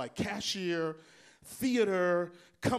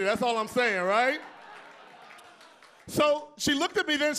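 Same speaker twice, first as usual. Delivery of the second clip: ordinary talk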